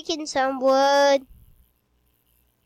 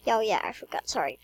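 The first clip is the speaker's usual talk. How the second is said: soft whispered aside; 6 semitones lower, 6 dB quieter, faster than usual. Real, not acted. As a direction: breathe it out as a whisper.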